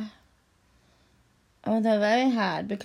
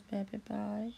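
normal talking voice

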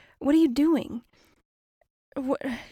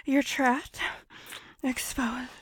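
predatory creature moaning; 4 semitones higher, 8 dB louder than usual. Acted.

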